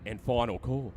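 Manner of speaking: rapid rolling auction call; auctioneer calling. Acted. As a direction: rattle off the bids fast. Live auction chant.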